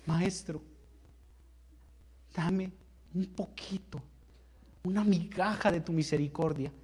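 soft, begging plea to the master; humble pleading. Acted. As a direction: beg quietly for a crumb of mercy. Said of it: "esta mujer se voltea y le dice".

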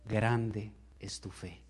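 slow, soft and solemn; tender praise. Acted. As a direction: bless her gently, drawing each word out. Jesus answers the woman.

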